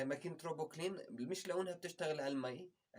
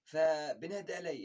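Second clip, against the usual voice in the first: usual speaking voice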